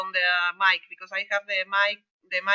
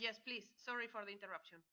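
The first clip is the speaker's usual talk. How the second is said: faint voice over a bad line; very faint, as if far from the mic; barely audible; 22 dB quieter than usual. Real, not acted. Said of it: "I don't hear you at all".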